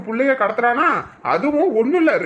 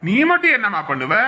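ordinary talk, nothing marked

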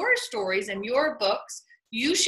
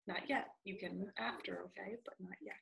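hushed aside; 7 semitones lower, 20 dB quieter, faster than usual. Real, not acted. Teacher softly telling a student not yet.

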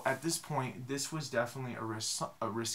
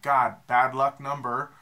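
dread; 9 dB louder, slower than usual. Retold or imitated. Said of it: "I'm like".

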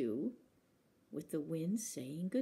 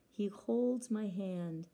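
ordinary delivery

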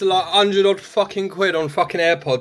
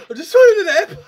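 laughing panic; 13 semitones higher, 7 dB louder, much faster than usual. Pretend.

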